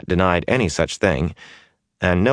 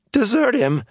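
much higher, indignant yelp; can't believe what he heard; outraged disbelief. Acted. Mat outraged at accusation.

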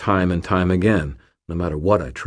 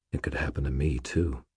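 plain reading voice

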